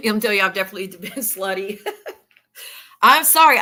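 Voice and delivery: talking through real laughter; laughing. Real, not acted. Admitting a joke on herself to viewers, laughing. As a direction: let laughter break into the words. Laughs at her own admission.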